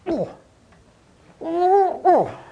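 high, effortful mock grunts; short strained "oh" of exertion; acted straining grunts. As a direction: grunt as if hauling a dead weight. Acting out straining to pull tire.

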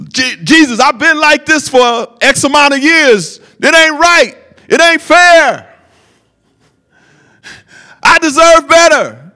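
high, loud, aggrieved complaint; whiny complaining beggar. Retold or imitated. Preacher mocks a bitter beggar's complaint, high and loud. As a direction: pitch it up and whine like he's been wronged. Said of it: "that wasn't his response".

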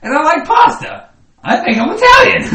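high, loud hammed-up Italian shout; Italian caricature. Two friends shouting a hammed-up Italian caricature. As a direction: belt it like a cartoon Italian chef. Stereotype Italian pasta bit.

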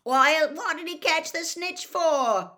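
high, loud, drawn-out protest; exasperated shouted question; bellow. A narrator's reading. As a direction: bellow it in disbelief. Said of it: "Ron bellowed".

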